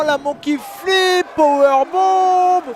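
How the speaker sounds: drawn-out high move call; excited shout. Real, not acted. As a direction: stretch out the move name. Calling big move name. (annonce criée du coup)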